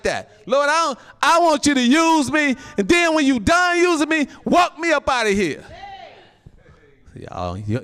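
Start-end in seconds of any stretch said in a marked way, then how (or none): 0.5-5.6 high, loud bargaining with God; preacher's full-voiced, high-pitched plea; impassioned plea. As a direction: cry out your request to the Lord. Acts out bargaining with the Lord.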